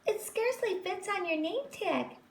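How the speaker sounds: high, mocking girl voice; teasing girl. A narrator's reading. Schoolgirl Rita pointing and teasing, high-pitched. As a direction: needle her with a high little voice.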